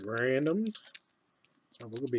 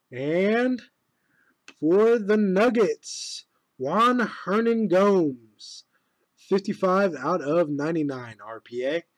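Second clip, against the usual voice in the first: announcer voice; 3 semitones higher, 6 dB louder, slower than usual. Acted. Announces card pull like a broadcaster.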